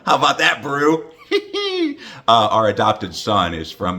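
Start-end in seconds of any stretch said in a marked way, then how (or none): 1.3-2.2 very high-pitched giggle. Real, not acted. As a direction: giggle at your own joke. Laughs at own brew joke.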